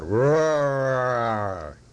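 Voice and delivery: long, drawn-out roaring "roar"; loud roar. A narrator's reading. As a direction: turn the word into a big animal roar. Narrator acts out the loud roar.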